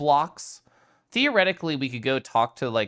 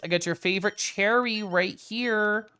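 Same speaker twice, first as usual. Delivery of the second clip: coaxing a pet; 8 semitones higher than usual. Pretend. Coaxing a creature with treat.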